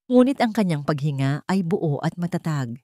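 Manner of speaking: ordinary delivery, nothing marked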